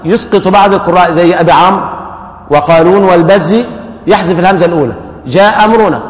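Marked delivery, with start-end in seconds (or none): 5.3-6.0 جاء أمرنا read as جا أمرنا; first hamza dropped. Shown as a demonstration. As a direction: drop the final hamza of جاء completely. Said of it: "يحذف الهمزة تماما".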